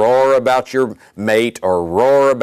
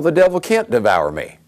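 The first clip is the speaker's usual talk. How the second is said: ordinary talk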